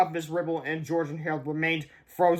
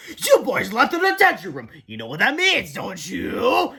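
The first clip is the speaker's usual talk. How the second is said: louder, menacing principal voice with swooping pitch; gloating principal; 5 semitones higher, 5 dB louder than usual. A narrator's reading. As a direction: relish catching the culprits. Principal catches boys, gloating.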